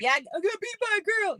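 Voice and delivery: high whiny mock of a boy who lost; mock beaten boy. Teasing cousins she beat, high whiny mock loser voice. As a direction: sulk it out high. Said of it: "mimicking a beaten boy".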